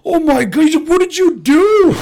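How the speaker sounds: loud, high outraged yell; hammed-up high shout of disbelief; mock horrified shout. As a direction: bellow it like Chris Farley losing it. Farley shouting at broken door.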